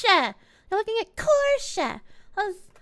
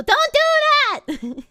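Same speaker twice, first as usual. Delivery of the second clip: mock squeal; 9 semitones higher, 5 dB louder than usual. Squealed at chat.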